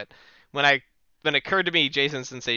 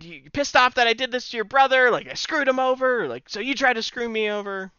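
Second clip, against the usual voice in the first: much higher, louder whiny wrestler taunt; mock Shawn Michaels promo; 10 semitones higher, 4 dB louder than usual. Shawn grabs the mic to taunt Owen, high whiny wrestling promo. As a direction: needle him like a cocky champ on the mic. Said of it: "He's like".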